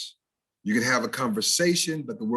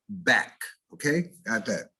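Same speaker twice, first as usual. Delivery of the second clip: usual speaking voice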